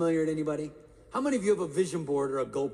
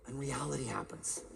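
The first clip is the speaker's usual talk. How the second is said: ordinary delivery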